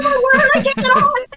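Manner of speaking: high, laughing mock panic; panicked cry. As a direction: wail it, half laughing at yourself. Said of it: "I said".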